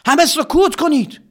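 high commanding shout for silence; loud high command. Acted. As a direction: order the whole army to hush. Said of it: "فریاد زد".

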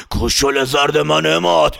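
slow, laughing menacing monster voice; slow taunting creature laugh; laughing dragon voice. Acted. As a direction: gloat through a laugh like a dragon. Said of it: "مثل یه اژدها".

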